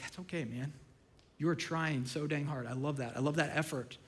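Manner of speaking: hushed, gentle encouragement; tender reassurance. Retold or imitated. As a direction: console them quietly and kindly. Acts God reassuring after failure.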